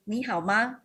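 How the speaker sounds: "Ni hao ma?" said in Mandarin tones; Mandarin greeting. Shown as a demonstration. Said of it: "in Mandarin, we say".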